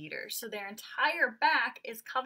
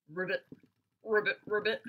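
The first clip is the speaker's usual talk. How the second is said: frog croak; 5 dB louder than usual. Shown as a demonstration. Teacher voicing the frog.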